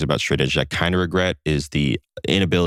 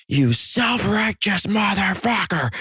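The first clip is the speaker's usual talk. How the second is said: high, drawn-out mock yell; imitated drunken-bar yelling; much slower than usual. Man berating a non-drinker at a party, imitated yelling. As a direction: slowly yell the insult, pitched way up. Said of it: "And he starts yelling".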